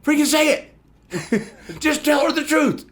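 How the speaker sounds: high frustrated yell at the screen; yelling at the movie. Retold or imitated. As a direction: shout it at the characters. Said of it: "you're going".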